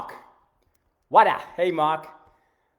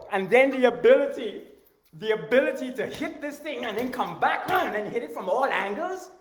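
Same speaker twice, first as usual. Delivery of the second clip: worked up; 8 semitones higher, 7 dB louder than usual. Real, not acted. Worked up demo narration.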